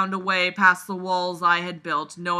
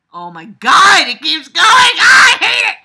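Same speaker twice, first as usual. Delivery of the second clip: shouting, climbing to a very high screech; exasperated shriek; 10 semitones higher, 18 dB louder than usual. Real, not acted. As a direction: yell it louder and higher until you screech. Exasperated outburst while reading.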